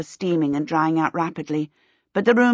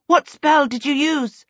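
high, raised-voice questioning; alarmed, insistent cry; urgent demand; 8 semitones higher, 4 dB louder than usual. A narrator's reading. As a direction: demand an answer in an emergency. Urgent shouting at burning Peter.

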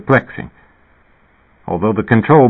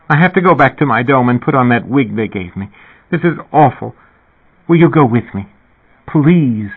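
higher, pleading woman's voice; distressed plea; 5 semitones higher than usual. A narrator's reading. Sick woman begs him to come along, distressed plea.

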